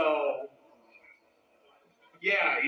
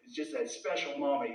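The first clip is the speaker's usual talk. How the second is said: usual speaking voice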